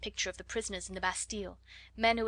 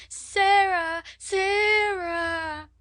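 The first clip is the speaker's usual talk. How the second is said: calling out wailing; 10 semitones higher, 11 dB louder, much slower than usual. A narrator's reading. Lottie wailing for Sarah.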